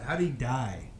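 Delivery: usual speaking voice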